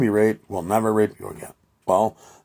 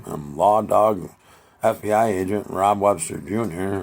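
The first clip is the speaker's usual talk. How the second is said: usual speaking voice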